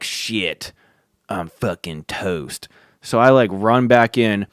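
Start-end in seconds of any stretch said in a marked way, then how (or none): 0.0-2.7 low, quiet, slow muttered dread; a hint of a defeated kid muttering to himself; doomed mutter. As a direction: mutter it like you know you're done for.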